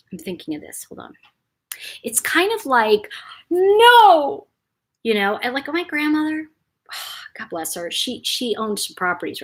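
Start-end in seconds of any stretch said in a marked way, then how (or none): 3.5-4.5 high, loud drawn-out "no"; mock outcry; emphatic no. Shown as a demonstration. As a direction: push out a long protesting no. Demonstrates an emphatic protest.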